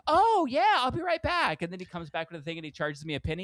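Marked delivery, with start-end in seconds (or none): 0.1-1.6 much higher, louder sugary waiter; sudden customer-service sweetness; fake cheerful waiter. Waiter suddenly eager once tip offered, much higher sugary voice. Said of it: "And he goes immediately".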